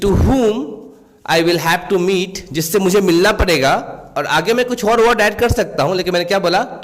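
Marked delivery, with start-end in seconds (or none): 0.0-2.5 slow example reading. Shown as a demonstration. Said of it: "teacher reads English example".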